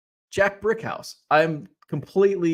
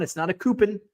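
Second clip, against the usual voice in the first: usual speaking voice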